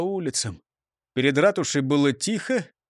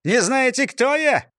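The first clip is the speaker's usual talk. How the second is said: high, self-important shout; pompous outraged yelling; indignant yell; 8 semitones higher, 3 dB louder than usual. A narrator's reading. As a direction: demand it in outrage, pitch climbing. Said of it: "заорал он и оглянулся".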